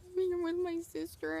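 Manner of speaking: high, squeaky attempt at speech; choked-up mock blubbering. Fan answering through tears, mock choked-up squeak. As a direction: imitate failing to get words out. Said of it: "can't make out my words".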